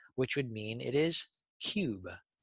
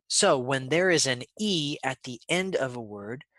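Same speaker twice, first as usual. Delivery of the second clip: normal talking voice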